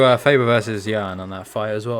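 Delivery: ordinary delivery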